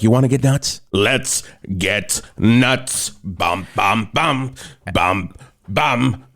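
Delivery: a hammed-up half-sung Batman bit; mock Batman number. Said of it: "Where it's like".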